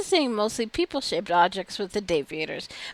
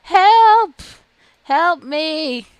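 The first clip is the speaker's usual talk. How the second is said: much higher, much louder cries for help; desperate scream; 7 semitones higher, 11 dB louder, much slower than usual. Acted. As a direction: scream it out in panic. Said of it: "his disturbing screams".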